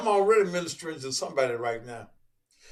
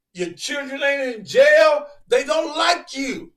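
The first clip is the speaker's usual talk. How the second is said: high, loud preacher build; preaching shout; 7 semitones higher, 5 dB louder than usual. Acted. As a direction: rail at the congregation at full force.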